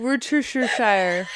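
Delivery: Worcestershire as wir-too-shuh-shire, slowly, through laughter; botched attempt. Shown as a demonstration. Friend tries Worcestershire another way, slow and muddled. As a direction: fumble the middle of Worcestershire slowly. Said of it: "five different ways without even trying".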